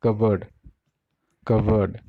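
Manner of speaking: cupboard as KUH-bud; R dropped. Shown as a demonstration. Teacher repeating for learners, KUH-bud for cupboard. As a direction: say cupboard with a weak second syllable. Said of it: "आर है पूरा हट जाएगा".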